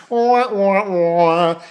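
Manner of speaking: drawn-out "wah, wah, wah"; mock wailing. Jeering at Jacqueline after his sarcastic question, mock wail. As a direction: imitate a whiny wail in mockery.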